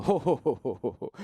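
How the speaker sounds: light mock Santa ho ho ho; Santa Claus laugh. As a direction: bounce the belly like a jolly Santa. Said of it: "Like a Santa Claus".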